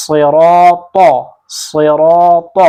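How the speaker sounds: slow, thick صراط with a heavy ص, the mouth full. Shown as a demonstration. Quran teacher showing students the heavy ص, slow and full-mouthed. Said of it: "تب بھی ہم اس کو موٹا پڑھیں گے".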